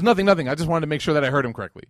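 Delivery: loud, high, hurried excuse; hasty backpedalling. Retold or imitated. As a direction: brush it off quickly, caught out. Salman hastily backpedalling to his master.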